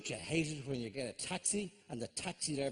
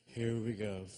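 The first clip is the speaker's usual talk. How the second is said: low, resigned aside; a touch of here-comes-trouble resignation; weary dread; 6 semitones lower, 4 dB quieter, slower than usual. Retold or imitated. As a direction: mildly groan it to yourself, lower. Dreading being recognised by lads.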